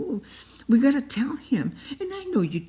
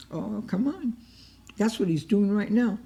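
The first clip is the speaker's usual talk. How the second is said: usual speaking voice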